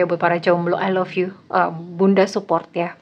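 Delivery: ordinary delivery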